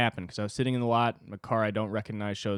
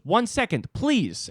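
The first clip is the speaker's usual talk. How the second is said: much higher, louder brush-off voice; dismissive delivery woman; 12 semitones higher, 5 dB louder, slower than usual. Retold or imitated. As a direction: imitate her breezy brush-off, pitched way up. Imitating Russian delivery woman.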